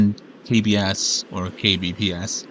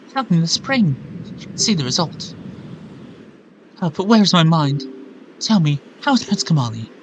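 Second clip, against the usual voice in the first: put-on English accent; 7 semitones higher, 4 dB louder than usual. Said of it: "Ah, she's English".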